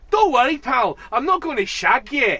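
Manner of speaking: hammed-up Glasgow bloke shout; loud Glaswegian reassurance. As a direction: bellow it as a friendly joke. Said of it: "he goes".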